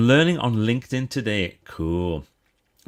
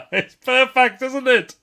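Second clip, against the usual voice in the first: laughing; 7 dB louder, slower than usual. Real, not acted. Laughing at the boss quote.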